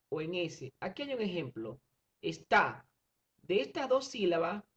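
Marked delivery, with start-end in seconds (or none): 2.2-2.9 "Está" with a loud final "tá"; stress on TÁ. Shown as a demonstration. Teacher showing sixth graders an example, stressed "Está". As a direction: punch the last syllable of "Está". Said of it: "la más fuerte sería ta".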